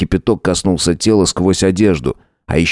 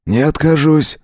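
guest's voice; 9 semitones higher than usual. A narrator's reading. Guest replies to Howard.

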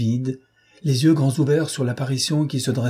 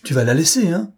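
sudden furious outburst, louder; sudden fury; 7 semitones higher, 4 dB louder than usual. Acted. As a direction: snap at him, suddenly furious. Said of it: "subitement furieuse". (explosion de colère soudaine, plus fort)

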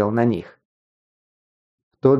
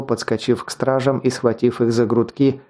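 ordinary delivery

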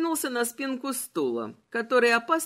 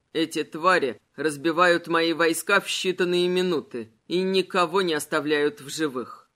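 skeleton voice; 5 semitones lower than usual. Acted. Malachi voiced. (низкий властный голос короля)

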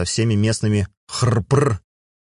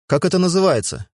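curious; 9 semitones higher than usual. Acted. Mui asks curiously.

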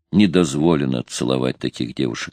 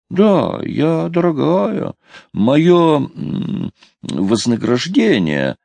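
haltingly searching for the word, higher voice; drawn out and tentative, as if asking; questioning, unsure; 10 semitones higher, much slower than usual. A narrator's reading. Girl naming her price to the sailor, questioning and unsure. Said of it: "словно она спрашивала, какое слово нужно употребить".

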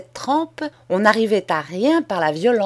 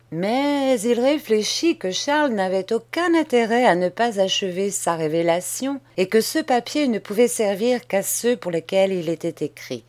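usual speaking voice

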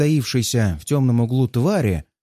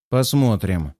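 smirking; 4 semitones lower, slower than usual. Acted. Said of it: "Усмехаюсь".

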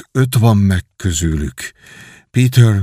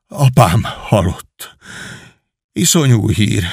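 slow, stunned grief; stricken, halting; slower than usual. A narrator's reading. Miles learns of his father's death, stricken and halting. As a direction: let each word drag as the shock sinks in. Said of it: "Máj szava elakadt, szája remegni kezdett".

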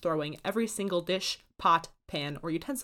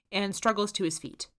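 ordinary delivery, nothing marked

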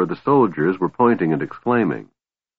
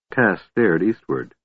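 plain reading voice

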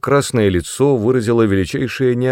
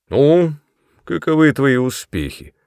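put-on drunk father; 6 semitones higher, 4 dB louder than usual. A narrator's reading. Drunk father questions daughter.

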